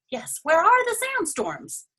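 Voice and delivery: pitched high with alarm; suddenly worried, voice jumping up; a high burst of worry. Acted. Dragon alarmed at clear sky.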